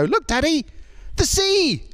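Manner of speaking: put-on high-pitched kid calling out to Dad; excited child. Kids at the beach calling to their daddy, excited child voice. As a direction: squeal it like an amazed little child. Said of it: "and the kids go".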